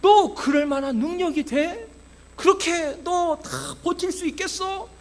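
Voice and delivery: high-pitched imitated jeer; mocking Satan. Satan questioning the pastor's ability, mock jeering. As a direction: taunt with one doubting question after another. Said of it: "저를 조롱하는 것만 같습니다".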